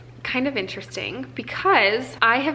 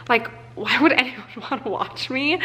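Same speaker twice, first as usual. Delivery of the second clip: a higher line broken by a laugh; self-deprecating laugh; 4 semitones higher than usual. Retold or imitated. She tells Hunter her doubt with a self-mocking laugh. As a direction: laugh off your own doubt.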